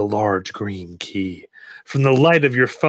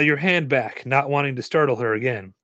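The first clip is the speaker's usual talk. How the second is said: plain reading voice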